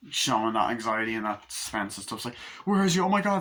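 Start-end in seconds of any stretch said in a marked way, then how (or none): 2.7-3.4 much higher, louder anxious cry; panicked. Acted. Parent realizing the child is gone, panicked. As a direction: fret in rising panic.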